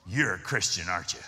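very low-pitched question; knowing seatmate. Retold or imitated. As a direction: imitate the man asking in a deep voice.

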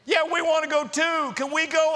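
put-on high, pleading children's chorus; eager kids. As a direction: imitate kids begging to come along. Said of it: "what do they say?".

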